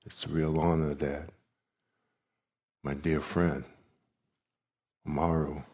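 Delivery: ordinary talk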